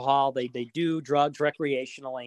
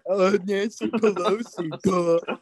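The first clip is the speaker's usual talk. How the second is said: mock dim boomer; 3 semitones higher, slower than usual. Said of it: "To spoon feed 'em".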